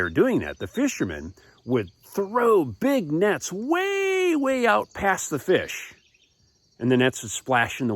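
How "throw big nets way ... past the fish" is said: slow, high, elongated "way, way"; stretched-out emphasis. A narrator's reading. Storyteller showing how far the nets fly, "way, way" stretched high. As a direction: draw out "way, way", pitched high. Illustrating a long net throw.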